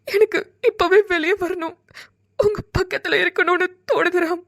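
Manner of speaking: crying as she speaks; tearful longing. Acted. Seetha wanting to come out to Ram now, tearful longing. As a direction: ache to be beside him, through tears.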